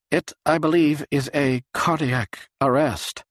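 ordinary delivery, nothing marked

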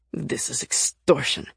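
low, disgruntled growl. A narrator's reading. Nick snatches his costly ticket up, growling. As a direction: grumble it low in resentment. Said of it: "Nick growled".

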